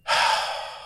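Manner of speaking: long, weary sigh; resigned sigh. Acted. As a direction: let out a heavy breath. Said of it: "so I just kind of go".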